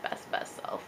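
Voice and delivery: whispered aside under the breath. Real, not acted. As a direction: breathe best, best self as a secret.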